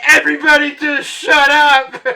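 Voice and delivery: loud, high retold outburst; exasperated shout. Exhausted climber yelling at his hecklers, exasperated. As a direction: yell it at everyone, hammed up.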